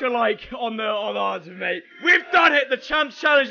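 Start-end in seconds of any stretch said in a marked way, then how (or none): 2.0-3.5 excited shout. Real, not acted. Celebrating completion.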